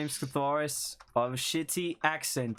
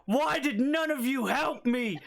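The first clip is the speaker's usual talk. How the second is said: high whiny complaint; 11 semitones higher, slower than usual. Acted. Catharus complains in character voice.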